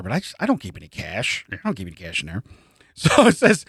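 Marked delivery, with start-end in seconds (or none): 0.0-2.4 low, hushed mumble; quiet. Retold or imitated. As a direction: murmur it under your breath. Acted quote of the victim.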